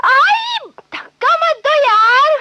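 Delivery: pained cry. Acted. Pushed on the bench.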